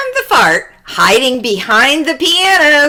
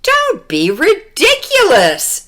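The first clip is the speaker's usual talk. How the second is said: dismissive mother; 7 semitones higher than usual. Acted. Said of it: "said her mother".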